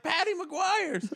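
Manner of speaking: high and slow, stretching the name; high drawn-out answer. Retold or imitated. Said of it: "You're like".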